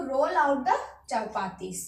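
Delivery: slow and careful; slow drill phrase; slow teaching repeat. Shown as a demonstration. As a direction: model the phrase slowly. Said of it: "I am rolling out".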